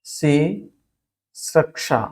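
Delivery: सिसृक्षा broken into सि and सृक्षा with a pause; syllable by syllable. Shown as a demonstration. Teacher introducing a new word, syllable by syllable. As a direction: say सिसृक्षा slowly in two separate parts.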